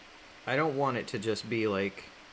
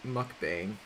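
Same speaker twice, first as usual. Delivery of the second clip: ordinary delivery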